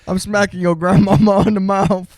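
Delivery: mock threat. Co-host joking about smacking your grandmama, loud mock threat.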